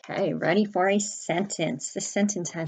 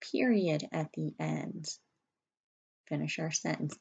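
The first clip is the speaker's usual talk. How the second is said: ordinary talk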